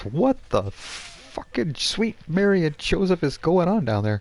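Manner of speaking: high, slow, exasperated rant; angry Irish publican. Acted. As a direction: let fly at the mess. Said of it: "you get a text from Seamus".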